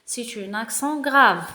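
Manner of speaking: ordinary talk, nothing marked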